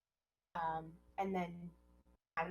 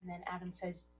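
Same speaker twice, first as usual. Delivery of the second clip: usual speaking voice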